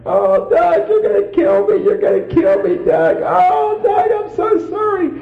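high wailing imitation of a sobbing wife; mock bawling. Wife on her knees confessing the lost baby, high mock wail. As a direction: wail it like she's falling apart. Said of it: "bawling and screaming".

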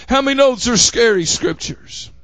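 ordinary talk, nothing marked

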